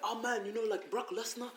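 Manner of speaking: a light mock English accent. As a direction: gym mate chatting in a put-on English accent. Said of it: "I'm trying to do my English accent".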